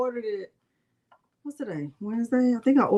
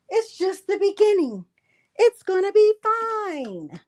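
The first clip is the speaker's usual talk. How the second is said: high, slow, singsong comfort; reassuring herself in a high mock sweet voice; mock cheerful reassurance; 9 semitones higher, slower than usual. Crafter mocking the cheery advice she was given, in a high singsong.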